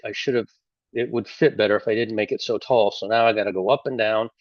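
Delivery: ordinary talk, nothing marked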